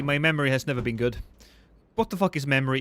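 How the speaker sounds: ordinary talk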